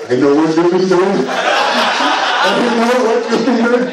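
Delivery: slow, drawn-out knowing voice with a laugh; hammed-up suspicious father, slowed right down; knowing dad accusation. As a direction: drag out the suspicious dad line.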